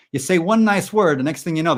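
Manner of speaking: high, loud comic complaint; mock-outraged, punched-up delivery; exasperated rant. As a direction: launch into it worked up. Mock rant about clingy universe.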